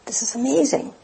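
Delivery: hushed, awed whisper; quiet amazed gasp of discovery. Retold or imitated. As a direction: whisper it in wonder. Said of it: "You go".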